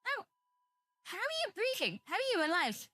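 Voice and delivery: high-pitched mock disbelief; astonished questions, voice leaping high; incredulous reaction. Acts out amazed reaction watching porn.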